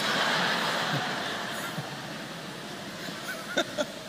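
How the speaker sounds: breathy high-pitched giggle; high airy laugh. Real, not acted. Pastor laughing at his own story, high and airy.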